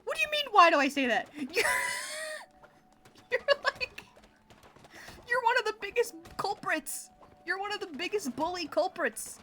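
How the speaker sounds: loud, high playful accusation; mock outrage. Real, not acted. Streamer accusing chat of bullying, loud mock outrage. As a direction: call chat out, half laughing.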